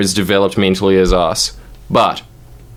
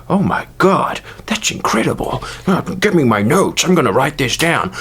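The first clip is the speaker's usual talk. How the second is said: higher, excited mock philosopher; Nietzsche impression; 4 semitones higher than usual. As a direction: gush like an amazed scholar. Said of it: "The closest thing he would have had was just like".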